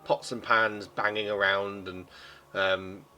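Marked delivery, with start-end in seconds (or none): none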